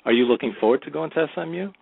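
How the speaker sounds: normal talking voice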